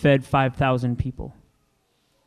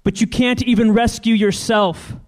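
higher, louder jeering crowd; mocking shout; 9 semitones higher, 4 dB louder than usual. The crowd shouts taunts at Jesus on the cross. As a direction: shout it up at him in scorn. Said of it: "the people shouted".

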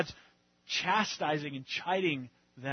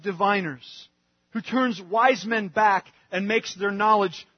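louder, rising preacher voice speaking as God; preaching at full force; 4 semitones higher, 9 dB louder than usual. Acted. Preacher speaking as God to the congregation, louder and fervent. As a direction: proclaim God's eternity over the congregation.

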